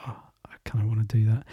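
fast whispered longing; whispering. Acted. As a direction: whisper it quickly to yourself. Pig tempted by play.

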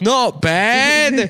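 high, loud mock Aussie; hammed-up Australian audience member; Australian accent. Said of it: "who's Australian".

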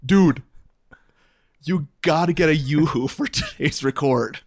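friend's text read out as a mock urgent plea; playfully hammed-up text message; Anthony. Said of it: "little text from Anthony".

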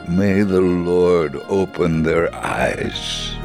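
plain reading voice

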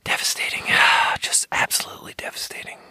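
whispered and soft, hammed-up devastation; mock despair. Wry comment on her own country, a hushed mock-devastated whisper. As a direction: breathe it out like a mock tragedy.

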